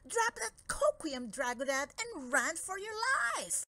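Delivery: high, urgent call to flee; urgent warning. Acted. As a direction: cry out a warning to run. Warning shout to dragon.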